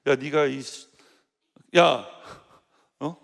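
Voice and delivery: put-on 야 snapped out like an older brother; stern scolding. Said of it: "좀 세게 얘기했어요". (성가대원을 세게 꾸짖는 말투)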